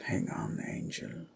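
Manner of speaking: low, soft, almost whispered; hushed vow. Acted. Husband promising his captive queen, hushed and low. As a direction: breathe it to someone far away.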